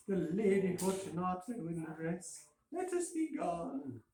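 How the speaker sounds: a trapped man feigning gaiety; Fortunato pleading. A narrator's reading.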